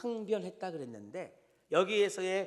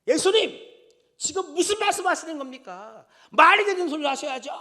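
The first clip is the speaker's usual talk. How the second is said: much higher and louder scolding, fast at the end; angry rebuke; 10 semitones higher, 13 dB louder than usual. Retold or imitated. Peter rebuking Jesus, loud indignant scolding. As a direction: scold him hard, indignant and sharp. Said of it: "막 이렇게, 여러분, 이, 이 꾸짖고".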